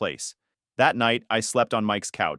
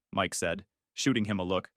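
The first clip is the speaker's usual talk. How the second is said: ordinary delivery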